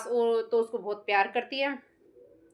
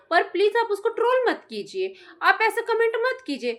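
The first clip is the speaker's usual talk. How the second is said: higher, mock-sweet pleading; mock pleading friend; 7 semitones higher, 3 dB louder than usual. Vlogger mimics the friend begging commenters, in a higher mock-pleading voice. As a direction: ham up her plea to the trolls, higher and sugary. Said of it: "वो बोल रहे हैं कि".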